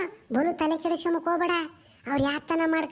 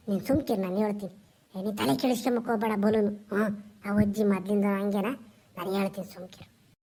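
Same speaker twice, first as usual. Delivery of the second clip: put-on lower, hushed old man calming his wife; 9 semitones lower than usual. Old man figure soothing wife. (ಕೆಳಧ್ವನಿಯ ಮುದುಕನ ಸಮಾಧಾನದ ಮಾತು)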